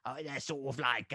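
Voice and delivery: lightly put-on player voice; a hint of the Gin Alley voice; Jimmy Butler. Trying out the player, lightly put-on impression. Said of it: "Jimmy Butler, you know, when he's like".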